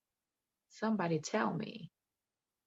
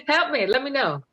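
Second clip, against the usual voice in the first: laughing plea; 11 semitones higher, 9 dB louder than usual. Real, not acted.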